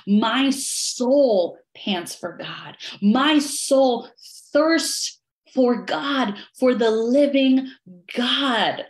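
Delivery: slow earnest psalm reading. Acted.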